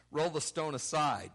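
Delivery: loud, forceful order; commanding. Acted. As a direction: command it like someone in charge. Voices Jesus commanding.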